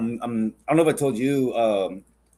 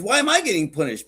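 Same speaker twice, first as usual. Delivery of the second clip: higher-pitched protest, lightly acted; protesting tiger; 7 semitones higher than usual. Tiger questioning the lion's punishment, protesting. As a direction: question it in raised-pitch disbelief. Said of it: "He goes".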